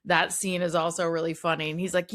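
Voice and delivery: usual speaking voice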